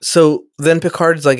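usual speaking voice

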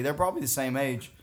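ordinary talk

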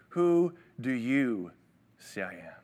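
slow, deliberate, pointed question; slow it right down and let each word land; Jesus asking his disciples pointedly. Retold or imitated. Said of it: "he pointedly asks them".

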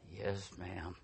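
soft, low, obedient answer; meek, scolded. Retold or imitated. Husband to his scolding wife, meekly obedient. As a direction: submit like a told-off boy.

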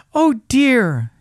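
high, loud little lament; dismayed little rabbit. A narrator's reading. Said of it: "he thought".

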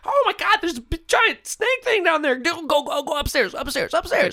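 very high, frantic terrified shriek; panicked screaming. Acted. GM voices children screaming in stairwell.

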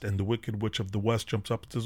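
usual speaking voice

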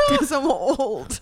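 put-on old voice. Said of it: "that's what old people sound like".

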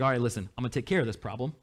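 mock-casual God promising a fix, quick and hushed. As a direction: rattle it off like a calm, unbothered God. Preacher voices God casually.

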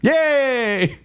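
high-pitched drawn-out yay; celebratory cheer. Real, not acted. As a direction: cheer for your own pick winning. Cheers his pick winning the vote.

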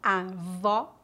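avó with open O, /ɔ/ like "aw"; stressed open ó at the end of avó. Shown as a demonstration. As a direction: say avó with the mouth wide open on the last vowel. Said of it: "aberto, como em".

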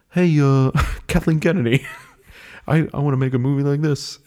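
lightly voiced eager director, laughing through it; imitating Taika. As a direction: pitch up a bit, giggling pitch to the boss. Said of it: "where he was like".

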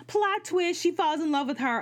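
spring the twist on them; sarcastic reveal of the romance; dramatic plot twist. Real, not acted.

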